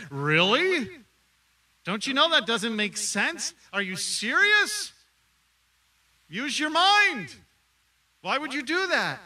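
loud, high, scoffing disbelief; mock incredulous scoffing. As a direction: scoff like a doubter who thinks you've lost it. Mocking people's reaction to faith.

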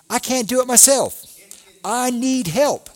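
high, loud cry for help; desperate plea. Retold or imitated. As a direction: plead like you truly can't do it alone. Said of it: "It basically means".

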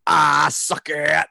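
high, drawn-out mock rock yell; slow, stretched-out imitation stage yell; James. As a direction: imitate a singer yelling to the crowd. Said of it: "James yelling".

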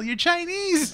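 high-pitched mock disbelief; playful squeaky indignation; retold exasperation. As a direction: imitate someone protesting in exasperation. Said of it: "He's all".

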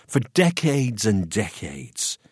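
plain reading voice

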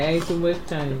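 low, soft echo of his line; imitating Little Joe. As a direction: quietly mimic the low mumble. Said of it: "That's what say".

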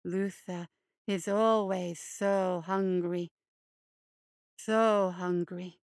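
low, slow, creepy hare voice; low creeping monster croon; hulking hare creature. Acted. Luther speaks again.

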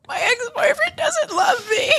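high, laughing sob through the words; mock sobbing wail. Retelling her shroom trip to listeners, a high laughing sob. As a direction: wail it like a heartbroken drunk, half laughing. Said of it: "And I'm crying".

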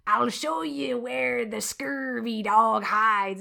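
very slow, hammed-up pirate accent; bad pirate accent. Host mocking the pirate actor's lines, bad pirate accent. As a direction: stretch every word in a mock pirate accent. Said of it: "trying to do some sort of accent".

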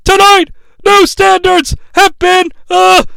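high, loud, dramatic announcer proclamation; wrestling announcer. Retold or imitated. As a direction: imitate a ringside announcer at full pitch. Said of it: "He then corrects himself".

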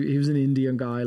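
ordinary talk

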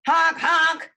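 loud, high honk honk; car horn honk. Acted. Car horn in her story.

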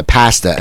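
"pasta" with a short a as in "past"; Philly-style flat-a "pasta"; father's accented pasta. Retold or imitated. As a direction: say "pasta" like PASS-tuh. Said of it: "He used to say pasta".

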